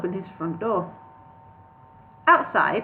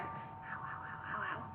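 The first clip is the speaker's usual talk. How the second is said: whispered little ows under the breath; under his breath; 22 dB quieter, slower than usual. A narrator's reading. Bingo winces under his breath walking to the door. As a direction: breathe the ows almost silently. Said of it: "half under his breath".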